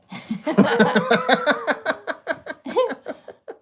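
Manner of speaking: long high laugh. Real, not acted. After own joke.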